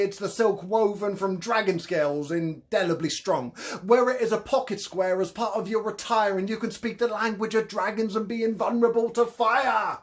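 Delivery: a grand Cockney hustler selling potions; Cockney con man. Acted. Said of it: "His thick Cockney accent".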